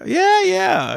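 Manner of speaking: high, quick cheerful yes; retold eager reply. Driver answering the shop owner, imitated eager reply. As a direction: pitch it way up, eagerly agreeable. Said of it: "He's like, "Oh, is that your car?".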